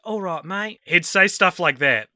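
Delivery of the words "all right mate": a quick London "All right, mate" in a light mock London accent; imitating a London accent, lightly. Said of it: "His, his London accent?".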